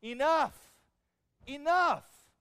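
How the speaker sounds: desperate prayer cry; crying out enough. Acted. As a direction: cry out to God in exhaustion. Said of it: "one word in his prayer".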